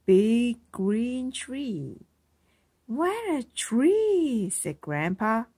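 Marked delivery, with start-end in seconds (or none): 2.9-4.5 higher, slow wonder; slow, higher wow; marveling. A narrator's reading. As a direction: let the tree amaze you. Grandpa marvels at tree.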